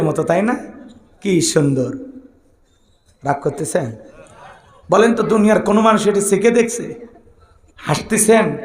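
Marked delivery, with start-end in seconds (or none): none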